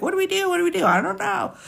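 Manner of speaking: high, flustered "what do we do"; mock panic. Retelling how everyone panicked over her mixed birth certificate, high mock panic. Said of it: "everybody was like".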